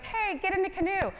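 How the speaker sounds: calling out. Retold or imitated. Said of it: "the person in the canoe is like".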